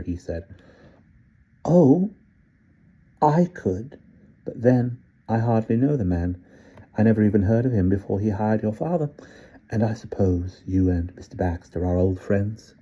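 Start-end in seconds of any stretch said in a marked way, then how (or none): 1.6-12.7 put-on sly old British gentleman. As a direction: coax the boy like a sly old tempter. Old man mocks gently.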